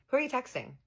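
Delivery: high-pitched, pointed question; mock interrogation of a partner; retold suspicious questioning.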